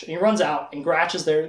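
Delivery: ordinary delivery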